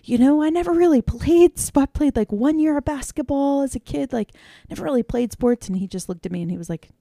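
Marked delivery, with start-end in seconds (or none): 0.0-5.7 high, loud anxious confession to the head coach; worried newbie coach pleading, high and loud; nervous pleading. Retold or imitated. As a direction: blurt out your worry, pitched high. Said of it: "I'm like".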